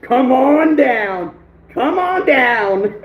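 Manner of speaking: slow, drawn-out game show call; game show host. Pretend. Game-show call for next viewer.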